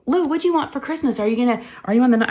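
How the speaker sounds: put-on high, hurried questions to a child; retold distracting chatter. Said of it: "trying to talk her through it".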